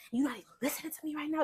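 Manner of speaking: imitating the boyfriend's nagging; mimicked nagging. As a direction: whine it quick and accusing. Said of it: "He's like".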